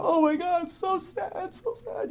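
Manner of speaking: high, breathy mock pity; high pitying teen voice fading soft; mocking teen girls. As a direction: squeal it like a gushing teenager. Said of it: "these young kids in high schools would go".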